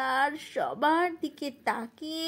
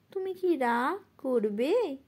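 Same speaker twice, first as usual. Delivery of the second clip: ordinary talk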